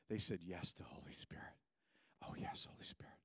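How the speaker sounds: soft, near-whispered yes; hushed reverence. Retold or imitated. Hushed retelling of their yes.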